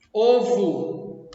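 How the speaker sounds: "Ovo" with the last o sounded as u; final O as U. Shown as a demonstration. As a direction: say "Ovo" ending like "ovu". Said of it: "som de U".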